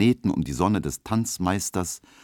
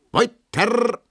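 calling out; 5 dB louder than usual. A narrator's reading. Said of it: "ruft der Tanzmeister".

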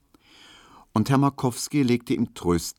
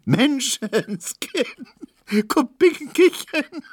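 slow, very high hysterical cry; drawn-out laughing shriek; shrieking with laughter; 6 dB louder, slower than usual. A narrator's reading. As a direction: squeal it out, helpless with mirth. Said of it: "schrie er".

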